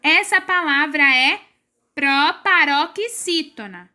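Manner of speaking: ordinary talk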